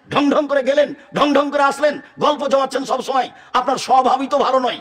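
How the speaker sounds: high, loud scolding tease; mock her strutting and gossip; preacher mimicking a nagging complaint. Mocking a showy woman.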